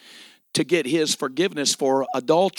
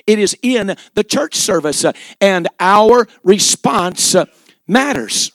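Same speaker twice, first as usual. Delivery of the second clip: loud, higher preaching climax; full-force preaching; 5 semitones higher, 10 dB louder than usual. Acted. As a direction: build the altar call to a shout.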